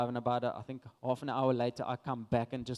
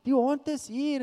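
high, loud farm worker's call; excited shouting; 11 semitones higher, 4 dB louder than usual. Retold or imitated. Farm workers calling the family out to the found dog, excited shouting. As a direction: shout the news across the farm, drawn out and high. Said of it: "the farm workers start shouting".

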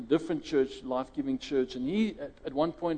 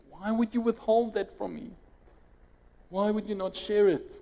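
upset pleading; 7 semitones higher than usual. Retold or imitated. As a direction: press him, wounded and indignant. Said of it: "and he's like".

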